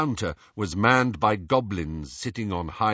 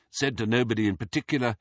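ordinary delivery, nothing marked

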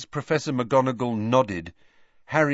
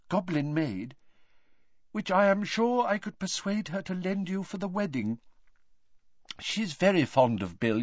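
conciliatory offer; 7 semitones higher than usual. A narrator's reading. Mrs Weasley offering peace.

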